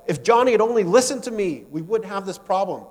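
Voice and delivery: acted flash of frustration, easing off at the end; exasperated anger. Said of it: "deep flash of disappointment and anger".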